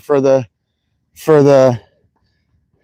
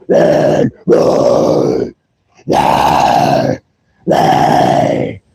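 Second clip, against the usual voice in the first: guttural growled scream, no pitch; harsh growl; much slower than usual. Shown as a demonstration. Streamer demos a metal growl for chat, guttural growl. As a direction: growl the words like a death metal singer. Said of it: "The growl makes you feel better".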